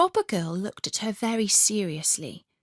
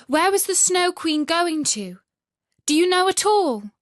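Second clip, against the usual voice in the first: high, loud eager call; crying out; 10 semitones higher, 6 dB louder than usual. Acted. Gerda calls to the pigeons, high and loud. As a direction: call across the dark urgently.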